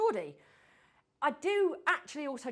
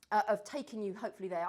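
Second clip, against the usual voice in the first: ordinary talk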